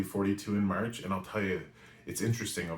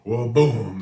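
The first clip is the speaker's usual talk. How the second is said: loud, higher burst on boom; re-enacted crash; 4 semitones higher, 12 dB louder than usual. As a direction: hit it like a sudden crash.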